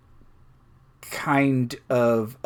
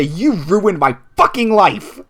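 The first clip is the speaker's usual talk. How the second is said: raised, loud outburst in character; angry accusation; 6 semitones higher, 5 dB louder than usual. Acted. Luffy confronting the rival who cheated, angry accusation. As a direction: hurl it at a bitter rival.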